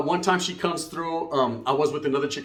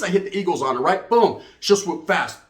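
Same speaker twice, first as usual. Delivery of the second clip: ordinary talk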